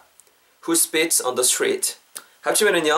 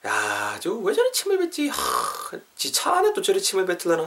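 exasperated grumble; 4 semitones higher than usual. Acted. Teacher playing a bystander appalled at someone spitting, exasperated. Acting out complaint about spitter.

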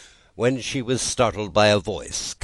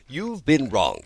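cried out; 8 semitones higher than usual. Acted. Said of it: "cried Tweedledum".

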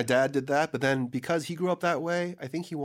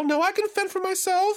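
much higher, offended voice; indignant girl; 5 dB louder than usual. Retold or imitated. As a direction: play the insulted girl turning down help. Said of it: "And they're like".

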